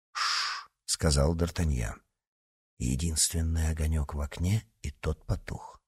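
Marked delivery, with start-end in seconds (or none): 0.1-0.7 soft unvoiced shush; hushing. D'Artagnan hushes his servant in the dark, soft shush. 2.8-5.8 low, soft murmur; hushed. Acted. D'Artagnan watches the dark house with his servant, hushed.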